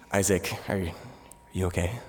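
low, soft concerned question; calling through the wall, quietly worried; host mom's worried call. Retold or imitated. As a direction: Peruvian host mom checking on him. Host mom calling into bathroom.

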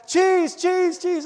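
high, loud imitated calling; screaming. Daughter holding the bag out to the dog, screaming. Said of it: "screaming at the top of her lungs".

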